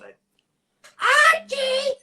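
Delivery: high shrill shout of Archie; Edith Bunker voice. Pretend. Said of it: "I can sound just like Edith".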